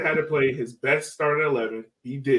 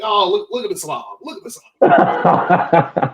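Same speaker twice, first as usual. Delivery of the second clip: teasing taunt, louder and slower, breaking into laughter; mocking jeer; 4 semitones higher, 8 dB louder, slower than usual. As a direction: jeer at him like a heckler, laughing. Mocking a shirtless fan.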